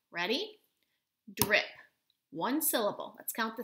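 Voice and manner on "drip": "Drip" punched out loud and firm; pounded word. Shown as a demonstration. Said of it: "We're gonna pound it out".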